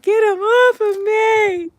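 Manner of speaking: high, loud, drawn-out plea; panicked scream. Retold or imitated. Screaming while chasing classmate for help, panicked. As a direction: wail it slowly at full volume.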